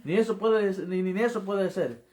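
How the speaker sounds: high, loud retold frustration; frustrated outburst. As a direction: cry out in frustration at yourself, reliving it. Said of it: "yo estaba tan frustrado".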